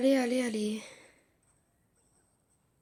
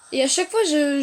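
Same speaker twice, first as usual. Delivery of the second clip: usual speaking voice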